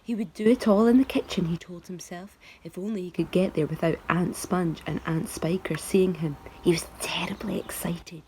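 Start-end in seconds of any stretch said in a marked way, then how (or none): none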